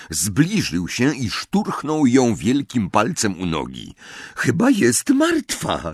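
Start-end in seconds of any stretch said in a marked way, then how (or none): 4.4-5.9 high, drawn-out cry of delight; gleeful call. A narrator's reading. Brave first-year pokes the body and announces it, gleeful. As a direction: call it out with joy, slowly. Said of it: "zawołał z radością".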